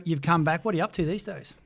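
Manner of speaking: normal talking voice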